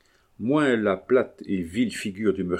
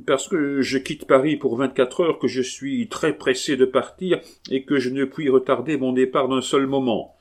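high, pressed, urgent excuses; anxious man pleading haste; frantic hurry; 6 semitones higher, 4 dB louder than usual. A narrator's reading. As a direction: rush to get away. Murderer desperate to flee.

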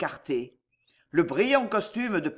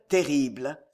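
plain reading voice

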